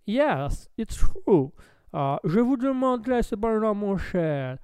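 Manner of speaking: put-on English-accented French, lilting. Lord replying to his dear friend, English accent. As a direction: answer as a hammed-up English lord. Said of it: "my lord".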